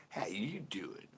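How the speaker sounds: soft imitation of a sitcom flirt; Joey from Friends. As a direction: murmur it as a mock pick-up line. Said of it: "Joey phrase from Friends".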